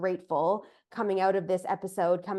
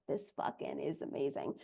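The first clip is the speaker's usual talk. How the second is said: low, very soft aside; hushed retold amazement; 7 semitones lower, 11 dB quieter than usual. Host retells her reaction to the guest's message, hushed amazement. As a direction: drop down low and quiet, almost to yourself.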